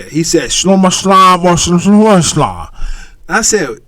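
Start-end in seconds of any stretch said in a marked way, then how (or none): 0.5-2.7 loud, slurred mock-Scottish mumble; mumbling Scotsman. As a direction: imitate an unintelligible Scottish coworker. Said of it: "a dude from Scotland".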